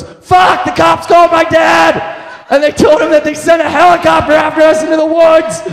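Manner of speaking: high, loud freaked-out outburst; high alarmed shout; panicked yell. Retold or imitated. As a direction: blurt it in total panic. Friend panics after dad's call.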